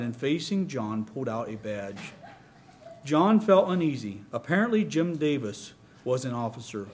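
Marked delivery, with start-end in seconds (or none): none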